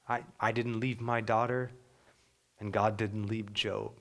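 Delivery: normal talking voice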